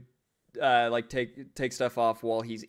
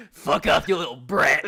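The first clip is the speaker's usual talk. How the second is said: hostile; 2 semitones higher, 6 dB louder than usual. Acted. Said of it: "Immediately hostile".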